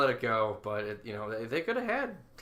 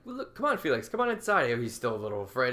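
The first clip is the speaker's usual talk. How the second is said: much higher coaxing voice, slowing at the end; coaxing Calvin; 11 semitones higher, 4 dB louder than usual. Retold or imitated. Calvin coaxing Felix into the bathroom, high and coaxing. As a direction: coax a nervous brother inside. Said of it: "he's like".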